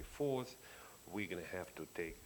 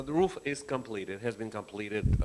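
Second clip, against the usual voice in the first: louder and clearer into the mic; into the microphone; 3 semitones higher, 11 dB louder than usual. Real, not acted. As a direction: speak up into the microphone. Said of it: "We need to use a microphone".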